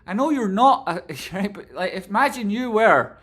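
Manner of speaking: loud, high, animated; playful pleading to chat; excited. Real, not acted.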